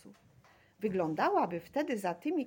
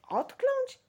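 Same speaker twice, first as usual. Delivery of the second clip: very high, rising bewildered question; puzzled; 5 dB louder than usual. A narrator's reading. Girl repeats the boys' odd word, puzzled. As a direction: echo the strange word in disbelief.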